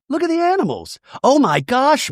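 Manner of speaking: high, gushing mock excitement; excited Mary. Mary pointing out the animals to Angie, gushing. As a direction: squeal it up high. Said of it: "Mary's like".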